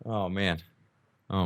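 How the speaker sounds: ordinary talk, nothing marked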